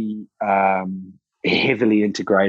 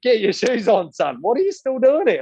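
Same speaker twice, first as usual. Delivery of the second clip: much higher, urgent mock scolding; Colin, hurrying him; 13 semitones higher than usual. Old cyclist chasing the trainer out, high mock scolding. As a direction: shoo him out the door. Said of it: "He's like".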